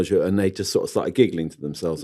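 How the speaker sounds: usual speaking voice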